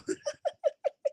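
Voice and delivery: soft high squeal of laughter; quiet high giggle; high wheezing laugh. Real, not acted.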